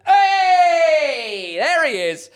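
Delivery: loud, high, drawn-out cheer; excited greeting. Real, not acted. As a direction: holler a long delighted hello. Greeting a viewer who appears.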